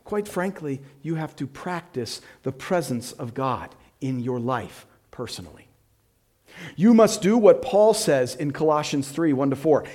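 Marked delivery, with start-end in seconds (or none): none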